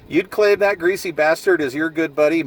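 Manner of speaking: ordinary delivery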